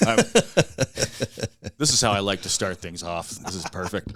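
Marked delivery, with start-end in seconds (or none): 0.2-1.7 breathy unvoiced laugh; real laugh. Real, not acted. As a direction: burst out laughing. Laughing at the banter.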